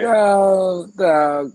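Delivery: loud, high exasperated cry of dog; exasperated shout. Real, not acted. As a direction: blurt it out in disbelief. Exasperated calling out to friend.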